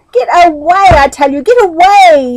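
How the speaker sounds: loud, high yell. A narrator's reading. Meddle yelling at the pestering fly, loud. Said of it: "yelled Meddle".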